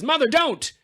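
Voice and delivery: high urgent cry of Mother, don't; a daughter stopping her mother in alarm; pleading cry. Retold or imitated. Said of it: "saves Darren and says".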